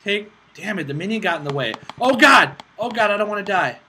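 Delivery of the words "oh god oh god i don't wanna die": panicked cry. Real, not acted. Panicking in game fight.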